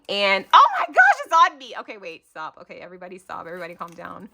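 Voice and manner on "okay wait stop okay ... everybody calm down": much softer, lower self-soothing; calming herself. Real, not acted. Vlogger calming herself after spiders, soft. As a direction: talk yourself down after a fright. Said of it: "I'm not gonna freak out".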